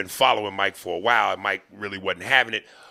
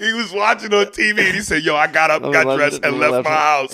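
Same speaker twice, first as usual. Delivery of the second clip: laughing; 5 semitones higher, 6 dB louder than usual. Real, not acted. Laughing retelling of the comment.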